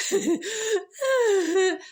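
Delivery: breaking into high laughter; laughing. Real, not acted. Storyteller cracking up at her own joke, laughing. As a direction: laugh through the word. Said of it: "that makes me laugh".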